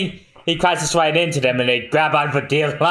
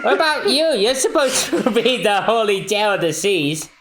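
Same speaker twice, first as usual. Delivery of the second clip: put-on high cheery boy; 8 semitones higher than usual. Voicing Luffy's cheerful banter.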